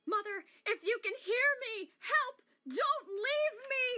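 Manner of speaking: high, loud desperate calls; anguished shouting. Acted. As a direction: shout the vow across the distance. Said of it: "That was great".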